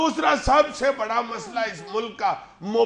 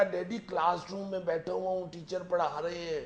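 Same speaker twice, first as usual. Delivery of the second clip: lowered voice, a bit softer than his own; hushed; 4 semitones lower, 6 dB quieter than usual. Retold or imitated. Son lying to dad on the phone, hushed. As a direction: keep it down so nobody around hears. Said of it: "آہستہ سے کہا".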